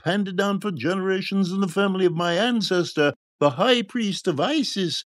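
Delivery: high-pitched quack's sales patter; grand, high showman's harangue; shouting charlatan. A narrator's reading. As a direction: pitch miracle cures to a fairground crowd. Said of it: "the charlatan was shouting".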